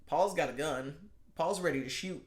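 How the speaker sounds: ordinary delivery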